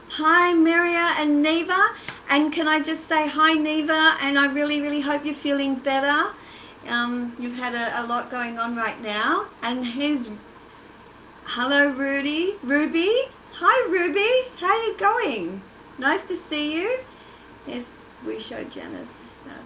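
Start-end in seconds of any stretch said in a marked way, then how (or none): 0.1-6.3 1 cheery greeting. Real, not acted. Greeting viewers in live chat. 11.4-17.0 1 excited greeting. Real, not acted. Greeting viewers by name.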